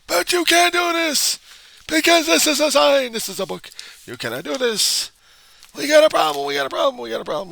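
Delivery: high, loud freakout that fades at the end; hysterical outburst. Acted. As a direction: freak out in a high voice, then wind down. Mock freakout over the sign.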